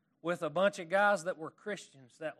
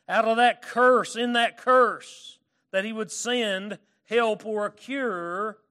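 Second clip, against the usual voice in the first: preaching at full force; 3 semitones higher, 8 dB louder than usual. Acted. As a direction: drive the point home from the pulpit. Preaching on the promise.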